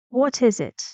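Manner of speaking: "What is it" high on "is", dropping on "it"; falling intonation. Shown as a demonstration. Teacher reads a question, falling intonation on "it". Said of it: "Falling intonation".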